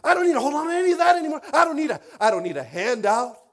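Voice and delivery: loud, high preaching cry; full-force pulpit shout. Real, not acted. Preaching climax.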